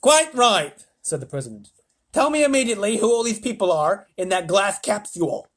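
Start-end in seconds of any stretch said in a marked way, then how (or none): 0.0-0.8 high loud agreement; president voice. A narrator's reading. 2.1-5.5 louder, higher order; commanding president. A narrator's reading.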